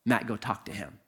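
low, hushed voice of conscience; quiet voice from within; inner voice. Retold or imitated. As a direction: speak it softly and low, as a calm command. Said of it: "there was this voice that said".

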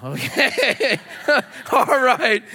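high, chuckling okay; amused sheriff. Acted. Sheriff humoring the emancipated citizen, chuckling. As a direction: laugh while humoring him. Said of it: "I can just see the sheriff going".

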